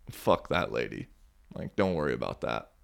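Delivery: low, soft, kindly aside; compassionate murmur. Retold or imitated. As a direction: comfort him quietly, man to man. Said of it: "the way he said it to me".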